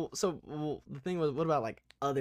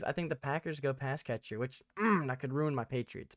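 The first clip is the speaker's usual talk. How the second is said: ordinary talk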